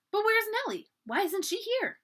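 high, mildly anxious questions; worried questioning. A narrator's reading. Samantha asking little girls about missing Nellie, worried. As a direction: pitch it up with concern. Searching for her missing friend.

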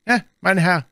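put-on high, loud boyish shout; stable boy calling out. A narrator's reading. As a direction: call after a stranger as a stable boy. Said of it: "rief ein Stalljunge".

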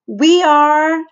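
high, loud eager cry. A narrator's reading. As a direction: call out together. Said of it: "cried Ashley A and Ashley B".

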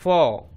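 फौ as f plus open 'aw'; blended syllable demo. Shown as a demonstration. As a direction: hold the vowel in फौ. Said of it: "तो बन गया".

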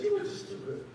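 high mocking sing-song; teasing coworker. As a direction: tease him for being too good.

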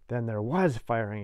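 plain reading voice

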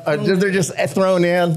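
usual speaking voice

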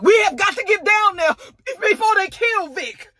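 high, hammed-up pleading with a crying edge; mock urgent Stacy. Stacy begs the others to rescue Vic, mock frantic. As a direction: play Stacy's sudden frantic concern. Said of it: "with all the conviction ... in her voice".

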